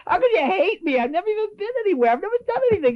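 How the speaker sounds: high, fast, bewildered; high incredulous protest; mock bewildered protest. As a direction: plead like a baffled newcomer. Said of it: "I said".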